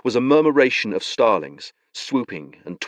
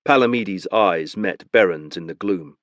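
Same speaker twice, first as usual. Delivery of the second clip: ordinary talk, nothing marked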